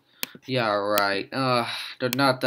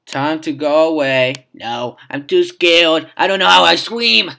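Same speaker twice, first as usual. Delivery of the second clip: panda voice; 4 semitones higher, 9 dB louder than usual. Pretend. Voicing the panda character.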